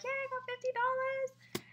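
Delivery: high-pitched mock cheer; excited winner. Winner celebrates fifty dollars at bingo, mock excitement. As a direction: imitate a thrilled winner in a put-on high voice. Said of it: "exciting to see people get excited".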